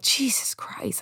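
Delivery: breathy stunned whisper; whispered Jesus Christ. Retold or imitated. Telling viewers about a TV scene, whispered disbelief. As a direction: mutter it under your breath in disbelief. Reacting to George coming back.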